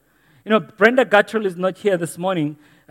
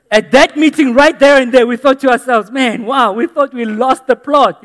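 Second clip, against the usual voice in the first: louder, higher, fired-up preaching; louder, higher preaching of a past meeting; preaching at full force; 6 semitones higher, 10 dB louder than usual. Acted. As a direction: drive the story home to the congregation.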